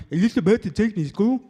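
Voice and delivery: put-on little boy asking the bus driver, lisping; lisping kid. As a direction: play yourself as a lisping child. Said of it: "I had a lisp".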